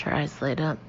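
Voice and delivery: ordinary delivery, nothing marked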